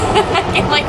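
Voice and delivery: ordinary talk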